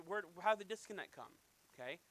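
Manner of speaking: normal talking voice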